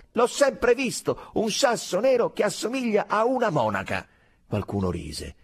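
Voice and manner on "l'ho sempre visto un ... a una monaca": stubborn insistence. A narrator's reading. Soldier answers the doubter, loudly stubborn.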